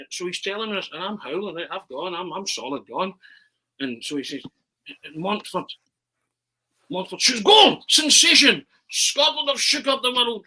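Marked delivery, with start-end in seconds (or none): 5.2-10.4 very high, loud, slow yell of goal, sensation; Montford commentary. Retold or imitated. [name] scoring his own penalty, ecstatic commentary call. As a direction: bellow the goal like a cup-winning call. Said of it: "commentating on myself".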